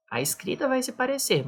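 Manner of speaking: ordinary talk, nothing marked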